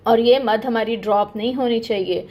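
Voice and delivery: normal talking voice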